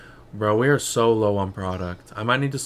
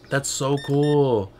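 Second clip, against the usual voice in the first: slow, stretched-out "That's so cool"; drawn-out awe; 3 semitones higher, much slower than usual. Real, not acted. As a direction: let "cool" hang in slow wonder. Slow drawn-out remark on the game.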